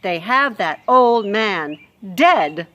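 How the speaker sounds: loud harsh Louise. Acted. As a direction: rant about the dungeon news.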